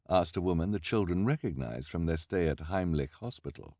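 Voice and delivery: usual speaking voice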